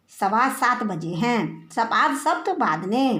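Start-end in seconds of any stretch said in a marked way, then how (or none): none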